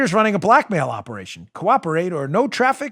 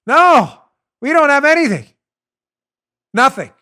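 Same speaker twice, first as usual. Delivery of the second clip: shouting; 9 semitones higher, 8 dB louder than usual. Real, not acted. Ranting host hammers his point.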